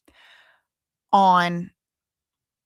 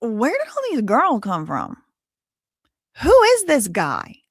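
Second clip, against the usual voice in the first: rising, louder 'who is this guy', hammed-up shock; mock disbelief; 4 semitones higher, 3 dB louder than usual. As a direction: act out her alarmed disbelief. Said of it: "so I'm like".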